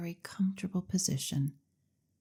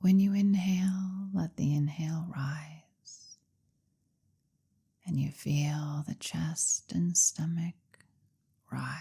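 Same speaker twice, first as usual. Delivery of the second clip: hypnotherapist's measured, lulling delivery; guided hypnosis. Acted. Leads a guided hypnosis session.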